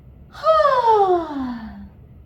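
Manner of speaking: high, loud phonated yawn sliding down; yawning sigh. Shown as a demonstration. Coach demonstrating a voiced yawn, sliding sigh. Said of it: "Do it again".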